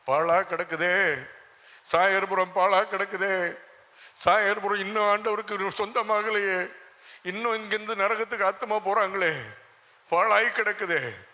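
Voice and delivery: loud, high wailing lament; anguished lament. Acted. Preacher acting a man weeping over his ruined town, anguished lament. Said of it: "அவன் அழுகுறான்".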